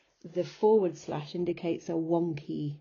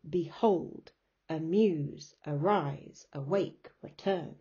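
each word, Behold to return, punched on its second syllable; da-dum beat on Behold, amuse, arise, awake, return; iambic stress; slower than usual. Shown as a demonstration. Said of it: "you'll hear the da-dum sound".

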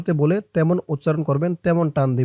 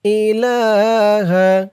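slow, higher chanted tajweed recitation; Quranic recitation; 4 semitones higher, 4 dB louder, much slower than usual. Shown as a demonstration. Teacher recites Ayatul Kursi for students to repeat. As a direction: recite with long drawn vowels.

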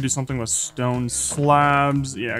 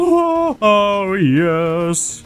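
delighted laugh; 4 semitones higher, much slower than usual. Real, not acted. Lucky drop, laughs.

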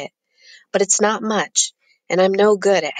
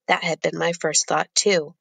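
plain reading voice

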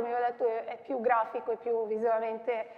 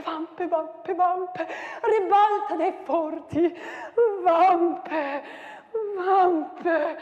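declaimed with wild swings of pitch and loudness; dramatic poem recital; 7 semitones higher, 9 dB louder, slower than usual. Acted. Teacher performing the battle poem for students, declaimed.

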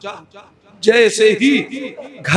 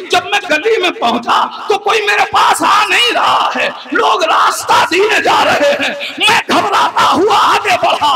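building sermon narration; 8 semitones higher than usual. Acted. Preacher telling the mourners of the girl's healing, building to a shout. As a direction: build the wonder line by line until you are calling out.

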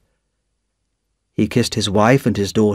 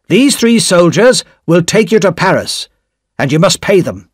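stern official; 6 semitones higher than usual. A narrator's reading. Official gives orders to emigrant.

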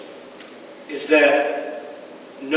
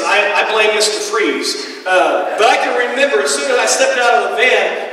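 usual speaking voice

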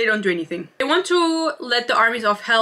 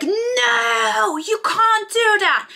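shocked protest; 11 semitones higher, much slower than usual. Retold or imitated. Sabine protesting to her father, high outraged shout. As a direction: shriek it like she's just been robbed of the throne. Sabine objects to heir choice.

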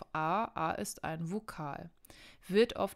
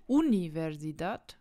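"Universidad" wrongly stressed on the opening U; stress on first syllable; 5 dB louder than usual. Shown as a demonstration. Teacher showing a wrong way, first syllable of "Universidad" stressed. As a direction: punch the U at the start of "Universidad". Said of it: "wir sagen nicht".

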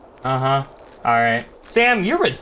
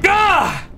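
startled yelp; 10 semitones higher than usual. Real, not acted. Startled in horror game.

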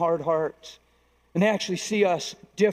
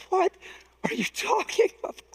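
high-pitched disbelief; incredulous; 12 semitones higher than usual. Retold or imitated. Preacher retelling his shocked reply to a wife, incredulous. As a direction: squeak it out, aghast at the question.

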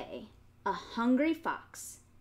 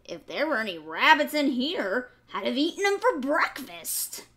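louder, smug fox muttering; sly fox thought; 4 semitones higher, 7 dB louder than usual. A narrator's reading. Fox thinking to himself, smug. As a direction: sneer a little. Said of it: "thought the fox".

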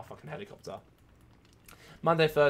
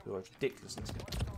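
normal talking voice

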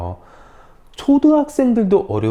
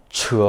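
치 rising to high; second tone; 7 dB quieter than usual. Shown as a demonstration. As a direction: lift 치 like a question. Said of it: "이성".

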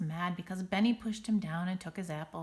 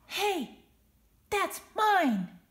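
high, loud protest; outraged kid shout; 12 semitones higher, 9 dB louder, much slower than usual. Acted. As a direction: shout it up from the floor. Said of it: "shouts Schubert".

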